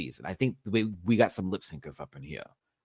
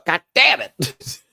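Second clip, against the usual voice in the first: higher, louder, slow drawn-out groan of loss; mock anguished cry; 10 dB louder, slower than usual. Vlogger mourning a queen's elimination, drawn-out mock wail. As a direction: wail it like a fan losing a favorite. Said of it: "I was like".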